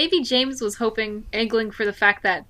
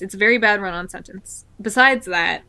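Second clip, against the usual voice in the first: ordinary talk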